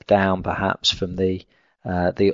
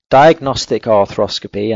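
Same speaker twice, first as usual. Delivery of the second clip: "diagnostic" hit louder at the start; stress on diagnostic; 5 dB louder than usual. Real, not acted. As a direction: lean firmly on the word "diagnostic". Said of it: "I stress the word diagnostic".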